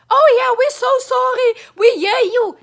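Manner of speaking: mock-sympathetic politician, lightly voiced; mock sob story. Vlogger mocking politicians' condolences, lightly hammed-up sympathetic voice. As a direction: sneer at politicians' hollow sympathy. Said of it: "You give people sob stories".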